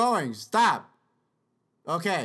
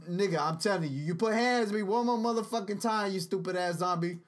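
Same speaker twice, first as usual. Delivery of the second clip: ordinary talk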